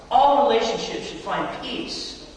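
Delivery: ordinary talk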